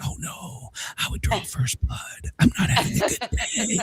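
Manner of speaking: half-whispered mock warning, breaking into laughter; hushed threat. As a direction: hush the comeback, let a laugh creep in. Muttered comeback to the threatening lady.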